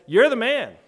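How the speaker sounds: loud, high, pointed accusation; stern accusation. Retold or imitated. Nathan accusing David, loud and condemning. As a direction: jab the finger with your voice. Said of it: "And Nathan says".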